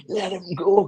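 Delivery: high mock yell without full volume; retold shouting. Ex-player retelling lads breaking up a strangling, mock shouting. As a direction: imitate teammates calling out urgently. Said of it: "all the lads were shouting".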